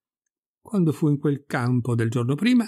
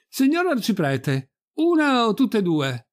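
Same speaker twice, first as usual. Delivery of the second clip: high, drawn-out slow call; 8 semitones higher than usual. Acted. Pirolo calling from the window to the priest, calling out. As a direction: holler from a window to someone outside. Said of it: "affacciandosi alla finestra gridò all'arciprete".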